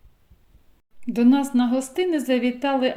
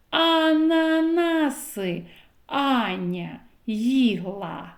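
slow tongue twister with every а stretched; slow stressed A tongue twister; A sounds emphasized; 3 semitones higher, much slower than usual. Shown as a demonstration. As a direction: draw out each а in the rhyme. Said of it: "який звук я виділяю голосом".

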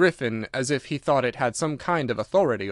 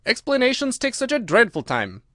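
high, exasperated complaint; impatient; 10 semitones higher, 3 dB louder than usual. A narrator's reading. Gryphon refuses explanations, high and impatient. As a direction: complain with pitch pushed up. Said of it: "in an impatient tone".

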